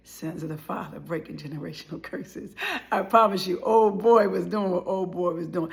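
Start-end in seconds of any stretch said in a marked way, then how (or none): 0.1-2.5 soft, low hushed refrain. Real, not acted. Telling viewers of family sin, a low hush. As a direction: murmur it solemnly.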